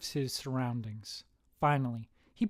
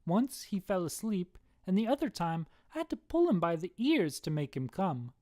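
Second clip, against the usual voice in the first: higher, lilting gossip; Irish wife; 5 semitones higher than usual. Acted. Wife answers husband's buddy, higher Irish voice. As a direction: confide in an Irish lilt.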